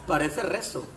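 usual speaking voice

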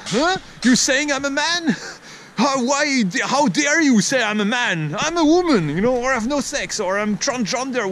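high-pitched affronted protest; mock outrage. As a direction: act out taking great offence. Acts out someone misgendered, offended.

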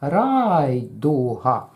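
Райдуга very slow, first syllable held long; Райдуга drawn out, long first syllable; stretched rhythm demo. Shown as a demonstration. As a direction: hold РАЙ, then two quick beats on дуга. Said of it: "Промовляємо".

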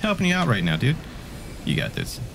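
usual speaking voice

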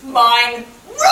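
put-on loud childish shout breaking into laughter; kid shout. Little boy shouts back at mom, then laughs. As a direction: holler it, then crack up. Child insists in role play.

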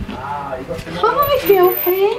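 high sing-song greeting. Real, not acted. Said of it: "Isn't that what you say?".

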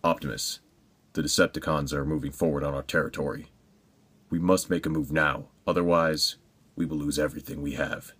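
low, hushed hero in the Batman vein; deep, quiet cartoon-hero delivery; standard hero voice. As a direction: play a grave hero, low and soft. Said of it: "do my standard hero voice".

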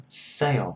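"Sale" with syllables glued together; "Sale" as one smooth glide; joined sale. Shown as a demonstration. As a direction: slide "Sale" from ay into a dark o. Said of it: "cho nó dính với nhau đó".

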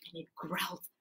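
usual speaking voice

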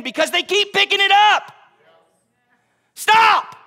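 rising to a high, loud cry; preacher's shout. Real, not acted. Preacher shouting to the church to stop. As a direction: cry it out to the congregation. Preacher crying out against bondage.

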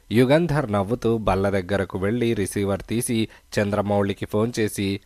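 plain reading voice

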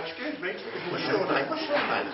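a hint of an eager woman asking; mock woman's voice. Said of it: "she'll be going".

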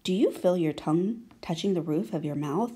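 normal talking voice

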